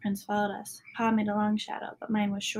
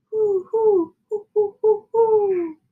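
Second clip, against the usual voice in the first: high, loud hooting like a great horned owl; 11 semitones higher, 11 dB louder, much slower than usual. A narrator's reading. Father calling to the owls in the woods, high owl hoots. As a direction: call out like an owl in the night. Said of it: "the sound of a great horned owl".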